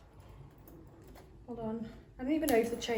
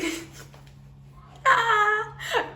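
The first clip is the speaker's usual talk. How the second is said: laughing; 12 semitones higher, 6 dB louder than usual. Real, not acted. Laughs at own idea.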